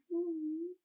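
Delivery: high, soft little voice; small high-pitched murmur, acted; mock self-satisfied. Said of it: "I'm like".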